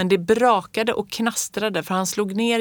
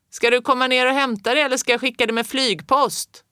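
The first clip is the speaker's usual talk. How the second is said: high, raised jokey shout; joking call; 6 semitones higher, 3 dB louder than usual. A narrator's reading. Postman jokes up at Fritjof, raised voice. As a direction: josh him from the yard.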